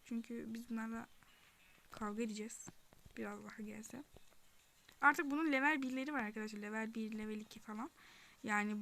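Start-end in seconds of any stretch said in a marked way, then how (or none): 3.2-4.0 soft whisper to herself; quiet whisper while playing. Real, not acted. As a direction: mutter it under her breath.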